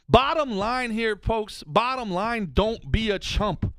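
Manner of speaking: slow, weighty stress on each word; slow emphatic point. Real, not acted. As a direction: drive the lesson home slowly. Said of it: "emphatic slow lesson".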